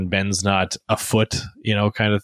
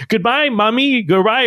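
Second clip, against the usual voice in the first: imitated toddler shout; 10 semitones higher than usual. Dad imitating his son's late goodbye after the door closes, mock shout. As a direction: imitate a child calling out a goodbye, pitched well up. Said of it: "and he starts shouting".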